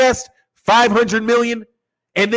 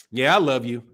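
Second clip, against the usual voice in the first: dismissive; 8 semitones lower, 5 dB quieter than usual. Shown as a demonstration. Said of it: "See the tone?".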